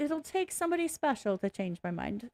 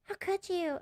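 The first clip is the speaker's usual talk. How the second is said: mock wounded pleading; 8 semitones higher, 5 dB quieter than usual. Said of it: "they're like".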